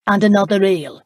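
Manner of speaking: a loud, pointed order thrown down as a dare; challenging. A narrator's reading. Justin daring Philip to match his drinking, loud and challenging. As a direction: say it like a gauntlet dropped. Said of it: "an unmistakable challenge in his voice".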